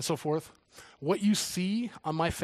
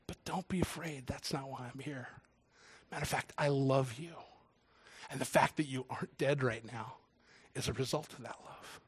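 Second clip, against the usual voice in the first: soft, lowered, tender voice; calm someone who is badly scared; gentle reassurance; 3 semitones lower, 6 dB quieter than usual. God tells a scared follower he is loved, softly reassuring.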